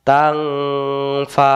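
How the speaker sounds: তাং held in a long hum before ফা; hidden nun. Shown as a demonstration. Teacher joining the syllables, long nasal তাং then ফা. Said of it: "গোপন করে পড়তে হয়".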